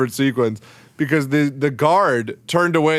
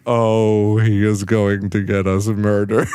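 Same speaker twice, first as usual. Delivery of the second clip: mock dread; 7 semitones lower than usual. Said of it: "Katara and Sokka are just like".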